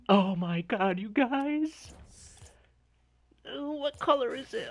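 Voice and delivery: high, loud excited squeal; giddy high voice. Real, not acted. Nervous excitement before reveal.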